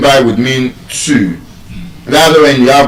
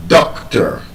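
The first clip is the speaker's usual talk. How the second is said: DOCtor, first syllable strong, second light; doctor stressed correctly on DOC; 8 semitones lower, 3 dB quieter than usual. Shown as a demonstration. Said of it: "the stress is on the first syllable".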